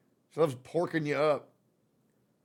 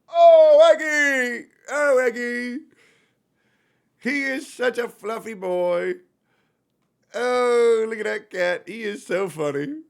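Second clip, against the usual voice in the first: gushing pet talk; 8 semitones higher, 6 dB louder, slower than usual. Real, not acted. Owner fawning over a fluffy cat, high-pitched cooing. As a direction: squeal adoringly, drawing the words out.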